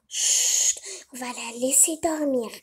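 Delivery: whispered shush, then soft quick words; hushed. Pretend. Mother doll hushing over the sleeping baby, softly. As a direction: hush so the baby keeps sleeping.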